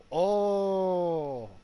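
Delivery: normal talking voice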